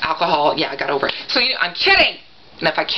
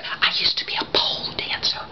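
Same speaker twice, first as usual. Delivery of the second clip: hushed, voiceless whisper; soft mock-confessional whisper; 7 dB quieter than usual. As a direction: whisper it like a secret being let out. Mock secret confession to daughter.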